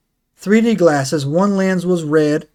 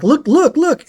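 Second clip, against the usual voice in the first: high, urgent repeated plea; imitating a frantic gunner; acted panic; 11 semitones higher, 5 dB louder, slower than usual. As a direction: urge him to see it, pitched way up. Retold gunner urging crewmate to see.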